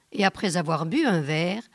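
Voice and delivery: ordinary delivery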